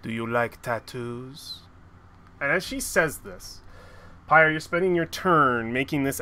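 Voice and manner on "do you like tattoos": low teasing question; a hint of menace in the tease; sly villainess. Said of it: "and she'll say".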